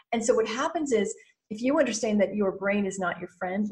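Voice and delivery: ordinary delivery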